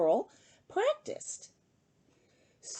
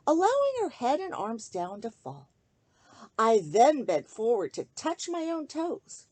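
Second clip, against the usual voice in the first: put-on rhyming picture book read aloud to children; lilting sing-song storybook reading; story narration; 3 dB louder than usual. A narrator's reading. Host reading a bedtime book to kids, gentle storytelling.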